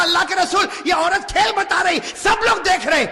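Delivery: excited plea. Acted. Aisha voiced asking to watch.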